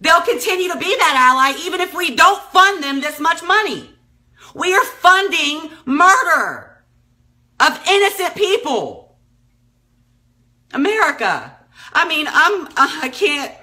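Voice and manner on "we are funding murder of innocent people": slow, each word weighted and raised; slow, emphatic. Real, not acted. Outraged vlogger accusing her country, slow and emphatic. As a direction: spell out the accusation slowly and higher. Outraged plea about war funding.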